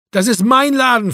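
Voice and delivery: high, loud protest; exasperated shout. A narrator's reading. Owner locked out by Marco, exasperated shout. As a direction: shout your claim through the door. Quarrel through locked door.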